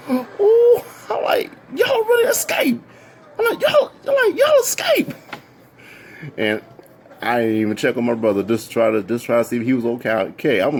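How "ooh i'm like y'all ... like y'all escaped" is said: very high, squealing fan voice; fangirl squeals. Retold or imitated. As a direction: squeal at the idols like a groupie. Squealing fan greeting the singers.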